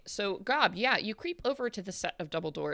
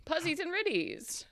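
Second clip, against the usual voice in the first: chirpy host voice; 10 semitones higher than usual. Pretend. Mock show title.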